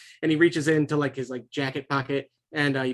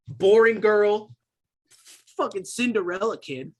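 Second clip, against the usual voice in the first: higher, drawn-out mocking insult; scornful taunt; 5 semitones higher than usual. [name] sneering at the group of kids, scornful. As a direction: stretch out the first insult with scorn.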